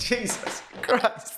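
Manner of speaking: breathy, laughing exclamation. Real, not acted. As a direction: laugh it out in disbelief. Laughing at the rating.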